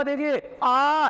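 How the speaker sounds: high, very slow देखिए आ; vowel आ demo. Shown as a demonstration. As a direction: open wide and stretch आ from the back. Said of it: "जैसे पीछे से निकालनी पड़ रही हो".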